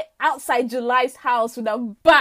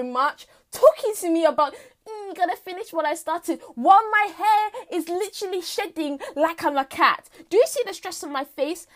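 higher, hammed-up indignant tirade; exasperated rant; 5 semitones higher, 5 dB louder than usual. Stressed September blaming March for ageing, exasperated rant. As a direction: let the grievance pour out, worked-up.

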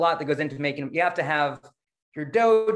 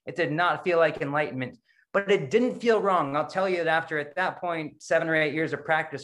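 normal talking voice

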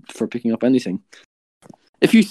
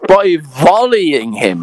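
slow, loud, disbelieving echo; shocked repeat; 4 semitones higher, 8 dB louder, much slower than usual. Real, not acted.

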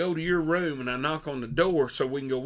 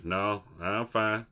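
lower, slow dismissive Berman voice; lightly mimicked buddy, lower and slow; imitating Berman; 5 semitones lower, 4 dB quieter, slower than usual. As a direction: shrug it off low and slow. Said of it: "You gonna go walk like that?".

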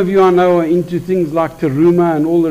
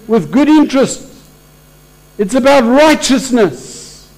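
preaching crescendo; 8 semitones higher, slower than usual. Real, not acted. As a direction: preach it out, lifting the room. Preacher building to climax.

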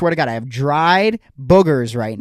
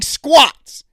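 exclaimed; 9 semitones higher, 6 dB louder than usual. Real, not acted. Exasperated rant about old guy.

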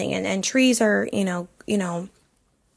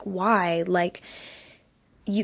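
usual speaking voice

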